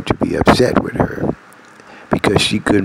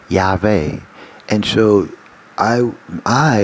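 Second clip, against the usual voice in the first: ordinary talk, nothing marked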